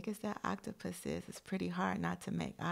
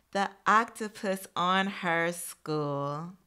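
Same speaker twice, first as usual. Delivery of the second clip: lively read-aloud storytelling, louder than her chat; storybook voice for young listeners; reading to children; 5 dB louder than usual. Acted. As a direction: read the picture book out to the class. Reads picture book to class.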